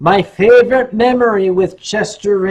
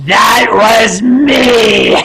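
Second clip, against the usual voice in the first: loud, drawn-out shout; shouted reveal; 4 semitones higher, 8 dB louder, much slower than usual. Real, not acted. Performer reveals to fans he wore the suit, slow shout. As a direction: belt it out very slowly, stretching every word.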